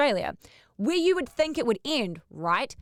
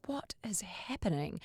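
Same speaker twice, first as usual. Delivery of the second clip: hushed confused whisper; whispered what is happening; 2 semitones lower, 11 dB quieter than usual. Retold or imitated. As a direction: breathe it out like a secret. Hours waiting, confused group.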